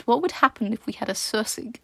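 plain reading voice

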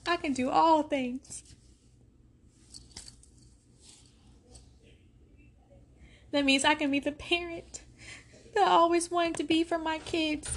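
pushing through real tears; tearful. Real, not acted. Mother telling parents she can do it, tearful. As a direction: voice rising high and choked with tears. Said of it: "I'm about to cry".

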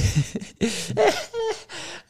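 squeaky high laugh; high-pitched laugh. Real, not acted. Laughs at own mockery.

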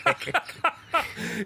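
a sudden high giggling laugh; high burst of laughter. Real, not acted. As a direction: break into helpless laughter. Laughing at friend's tease.

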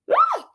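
high joyful squeal; excited squeal. Real, not acted. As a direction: let out a thrilled shriek. Excited outburst.

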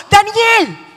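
king shouting for Daniel at dawn; desperate shout. A narrator's reading. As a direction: call the name high and loud into the pit. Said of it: "gritó".

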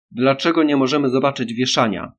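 loud full-voiced demanding yell; bellow. Boy demanding to see the hanging, loud bellow. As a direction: roar the question at full volume. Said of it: "ryknął chłopiec na cały głos".

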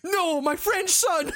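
hammed-up slow wail, high, half laughing; mock grief-stricken father. Father wailing over his dead French son. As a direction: overplay grief, laughing through it.

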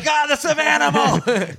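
high, slow, delighted call; dad greeting his toddler high and slow; excited greeting. Retold or imitated. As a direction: greet her like a wild animal. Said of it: "And I'm like".

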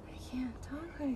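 whispered, drawn-out mock calm; strained whisper. Woman told to calm down, strained mock whisper. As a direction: squeeze it out in a slow whisper. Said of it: "Can you just calm down?".

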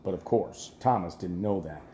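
ordinary delivery, nothing marked

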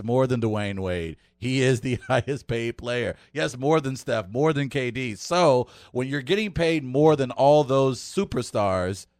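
ordinary talk

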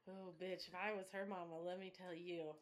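soft, low mock threat; low warning. Co-host imagining being that girl's mama, quietly menacing. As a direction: drop pitch and volume for a pretend warning.

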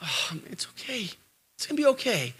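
soft, hushed comforting; soothing consolation. Acted. Adult consoling a heartbroken teen, softly soothing. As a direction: put on a gentle consoling voice.

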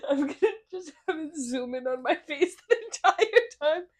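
talking through laughter; laughing. Real, not acted. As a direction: giggle through the line. Joking about zooming in.

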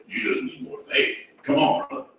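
soft, unvoiced imitation of a well-meaning friend; hushed mock advice. Preacher mimics advice to someone in agony, hushed. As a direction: drop suddenly to a near whisper.

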